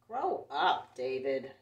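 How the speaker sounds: loud, dismissive big-brother telling-off; scornful big brother. Acted. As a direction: bark it down at a little kid. Big brother, read aloud.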